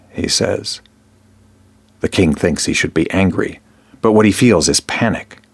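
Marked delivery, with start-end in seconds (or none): none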